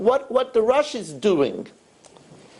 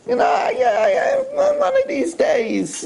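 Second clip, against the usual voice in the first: mock stalling. Married person putting off doing it right, mock stalling. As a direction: stall in a high, stretched voice. Voices a procrastinating spouse.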